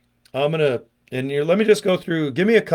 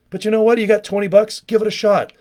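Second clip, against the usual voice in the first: higher and a bit louder, pushing hard; excited sales pitch; 6 semitones higher, 4 dB louder than usual. Real, not acted. To viewers, pitching throat spray with eager push. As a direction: sell it with rising enthusiasm. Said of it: "urging viewers to buy".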